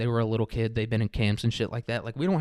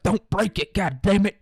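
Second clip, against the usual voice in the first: high, loud scolding from a dad; angry dad yell; 8 semitones higher, 6 dB louder than usual. Retold or imitated. Dad to toddler on the potty, angry yell. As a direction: yell it like an exasperated father.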